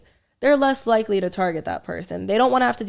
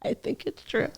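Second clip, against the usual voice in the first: whispered; 3 semitones higher, 9 dB quieter than usual. Real, not acted. Joking whisper after laughter.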